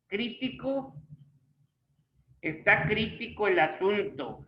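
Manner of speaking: "crítico" as CRÍ-ti-co; stress on the first syllable. Shown as a demonstration. Teacher showing where the accent falls, "crítico" stressed on CRÍ. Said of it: "por la forma en que se está diciendo".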